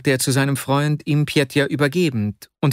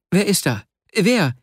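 higher-pitched eager call; raised-pitch, urgent questioning; 8 semitones higher than usual. A narrator's reading. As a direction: call out to whoever is at the door. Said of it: "rief Vasja".

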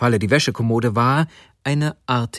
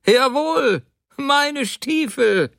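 very high, drawn-out insistence; emphatic outrage; 14 semitones higher, slower than usual. Acted. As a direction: proclaim it indignantly, high and stretched. Husband angrily insists they are his.

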